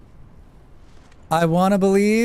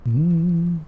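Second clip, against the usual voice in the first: ordinary delivery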